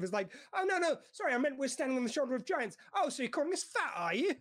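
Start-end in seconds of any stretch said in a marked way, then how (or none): 0.5-2.8 higher-pitched, flustered innocent apology; sweet, innocent apology. Retold or imitated. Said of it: "sweet and innocent". 3.0-4.3 mock offended. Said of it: "they're just taking offense".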